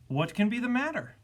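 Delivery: fretful, rising pitch; worried cry. A narrator's reading. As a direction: cry it out in mild alarm. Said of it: "cried the zookeeper".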